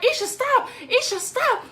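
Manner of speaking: a hint of a calming neighbor; lightly voiced neighbor. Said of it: "she's like".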